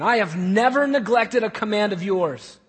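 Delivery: loud, emphatic declaration; sure of himself. Acted. Older son declaring his record to his father, loudly certain. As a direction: assert it forcefully as indisputable fact. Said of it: "he's sure of this. He's not asking a question".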